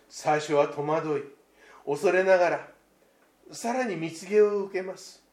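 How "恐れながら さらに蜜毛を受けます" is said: usual speaking voice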